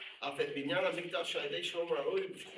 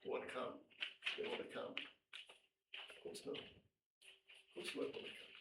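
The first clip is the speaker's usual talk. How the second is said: hushed sarcastic mutter to a no-show; mock whisper; 12 dB quieter than usual. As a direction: breathe it out like a sly jab. Mocking a reluctant congregant.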